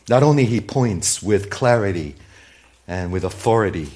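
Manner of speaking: ordinary delivery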